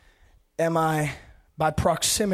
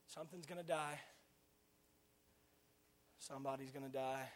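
hushed; 4 semitones lower, 17 dB quieter than usual. Real, not acted. Sudden hush after shouting.

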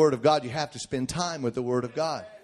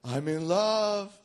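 slow, higher, swooning mock voice; drawn-out, softer dreamy gush; lovestruck teenager; 4 semitones higher, 4 dB quieter, much slower than usual. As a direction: imitate a lovesick teenybopper. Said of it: "get your heart involved and say".

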